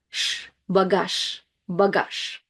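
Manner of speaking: devoice the last sound of "bagage" to sh; final Z as sh. Shown as a demonstration. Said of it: "So it sounds more like sh".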